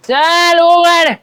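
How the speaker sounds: put-on drawn-out drunken holler; loud drawn-out call. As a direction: stretch it out slowly and loudly at the shut shop. Drunk man banging at shop.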